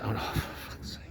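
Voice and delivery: whispered exasperated swear under breath; muttered curse. Real, not acted. Hearing the full-price news, whispering a frustrated swear. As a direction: curse it to yourself, barely voiced.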